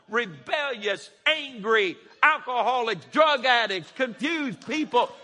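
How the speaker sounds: loud, rising sermon delivery; building preacher's shout; full-force preaching. Real, not acted. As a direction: preach it out to the back row.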